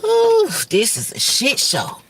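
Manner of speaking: normal talking voice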